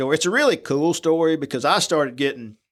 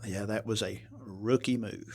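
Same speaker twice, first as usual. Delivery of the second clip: sheepish mumble; 3 semitones lower, 11 dB quieter than usual. Retold or imitated. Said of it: "I believe he responded in the".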